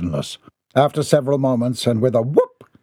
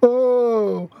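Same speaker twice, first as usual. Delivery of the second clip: high, drawn-out "oh"; startled high wail; frightened cry; 12 semitones higher than usual. A narrator's reading. Men startled by the thunder, high cry of fear. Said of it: "The men all jumped in fear".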